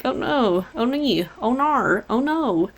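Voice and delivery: hammed-up little oh-nos, softly; mock dismay. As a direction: fake worry, half-joking. Mock worry over ill wife.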